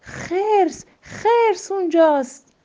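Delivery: high, loud cry of warning; panicked hunter shouting bear; shouting alarm. Acted. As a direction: yell to warn of the bear. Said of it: "داد زد".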